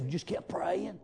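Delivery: unvoiced whisper of the servant's answer. A servant answering Jezebel's question in a whisper. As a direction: hush it like a secret.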